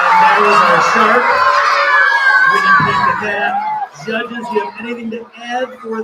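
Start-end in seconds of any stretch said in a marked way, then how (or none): 0.0-3.5 big loud host announcement; announcer shout. Real, not acted. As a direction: shout it out like a game show host. Host announcing reader to audience.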